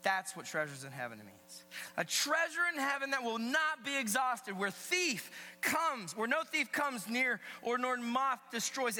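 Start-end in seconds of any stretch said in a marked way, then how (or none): 2.0-9.0 high, loud sermon voice; emphatic preaching. Real, not acted. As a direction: preach it to the whole room with punch. Preaches at full voice.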